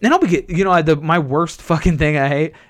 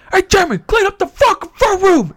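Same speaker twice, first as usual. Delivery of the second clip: higher, mock shouted order; mock dad yell; 3 dB louder than usual. Dad ordering son to clean, mock shout. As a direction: bark the chore at him. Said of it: "my dad's like".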